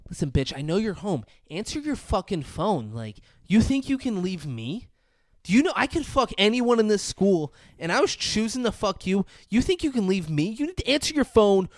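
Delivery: mock psycho ex ranting into a voicemail; angry threats. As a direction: threaten her down the phone, escalating. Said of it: "it gets to the point where he's like".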